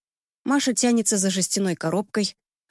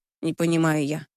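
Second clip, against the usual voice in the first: usual speaking voice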